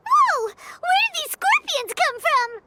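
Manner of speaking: sudden high startled cry, then alarmed; startled whoa. Acted. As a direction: jump at the ambush. Scorpions suddenly appear.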